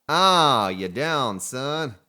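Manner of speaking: loud cheer of victory; a loud triumphant yell; triumphant whoop. Real, not acted. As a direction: whoop it up over the kill. Bagging the deer.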